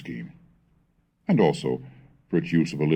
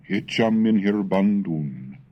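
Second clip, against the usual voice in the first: lyrical recital, higher and louder at the start, then settling; poem recitation; 7 semitones higher, 3 dB louder than usual. Acted. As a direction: recite it like a spring love song. Recites the Middle English poem.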